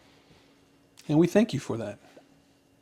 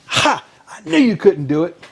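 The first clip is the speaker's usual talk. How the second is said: mock triumphant crow, higher and louder; 6 semitones higher, 6 dB louder than usual. Banker jumps up at winning the bet, triumphant.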